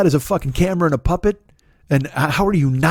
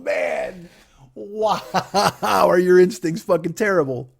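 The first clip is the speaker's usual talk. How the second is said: ordinary talk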